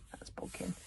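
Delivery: hushed whisper to the listener; confiding whisper; whispered aside. A narrator's reading. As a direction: lean in and breathe it. Aside naming the character.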